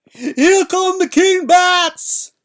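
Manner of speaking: high, slow, shrieking giant's scream of panic; terrified scream. A narrator's reading. Fezzik on the dark steps, screaming in terror. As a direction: scream it out in sheer terror, stretched long. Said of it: "Fezzik screamed".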